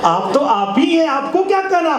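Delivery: high-pitched, emphatic showy flattery; emphatic mock deference. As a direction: lift the pitch sharply to placate someone. Repeats the line as emphatic advice.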